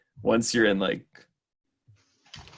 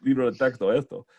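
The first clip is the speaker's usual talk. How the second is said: put-on Argentine accent; 4 semitones higher, 3 dB louder than usual. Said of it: "this girl from Argentina".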